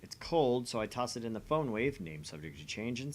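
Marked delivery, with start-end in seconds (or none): 2.0-3.0 dry aside. Acted. Parenthetical aside in game text.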